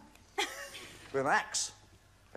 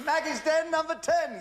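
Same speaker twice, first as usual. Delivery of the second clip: bingo caller; 10 semitones higher than usual. Retold or imitated. Host echoes bingo call.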